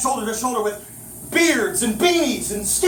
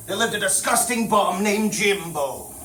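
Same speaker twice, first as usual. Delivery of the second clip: plain reading voice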